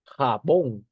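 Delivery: Jabón punched loudly on BÓN; stress on final syllable. Shown as a demonstration. Teacher giving the next example word, stressing BÓN in Jabón. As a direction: announce "Jabón" with a strong BÓN. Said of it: "se encuentra en bon".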